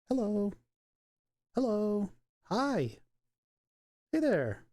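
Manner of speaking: high cooing greetings. Real, not acted. Greeting the dog in baby talk. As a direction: coo them up high to the puppy. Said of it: "Sorry for the baby talk".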